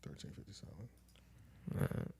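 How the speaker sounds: soft slow whisper. Real, not acted. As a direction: whisper it to yourself. Repeats timestamp under breath.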